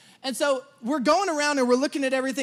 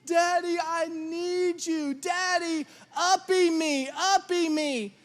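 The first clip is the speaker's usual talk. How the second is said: imitated toddler wail, high and drawn out; mock crying plea to be picked up; crying child; 5 semitones higher, much slower than usual. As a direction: whine it like a bawling little kid. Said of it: "Starts screaming, crying my name".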